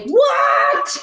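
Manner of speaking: a sudden shout, the "what?" jumping way up; surprised shout. Real, not acted. Baker shouting in surprise at her friend's surprise cake. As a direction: caught completely off guard.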